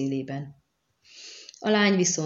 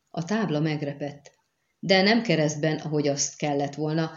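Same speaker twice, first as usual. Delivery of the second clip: usual speaking voice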